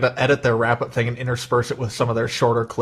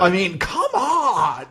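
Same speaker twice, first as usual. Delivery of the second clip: high, drawn-out cry of disbelief; exasperated outburst; 8 semitones higher, much slower than usual. Real, not acted. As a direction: wail it in slow, high indignation. Ranting about studio's stupid choice.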